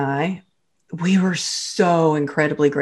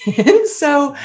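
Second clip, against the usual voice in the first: laughing; 7 semitones higher, 4 dB louder than usual. Real, not acted. Laughs while telling story.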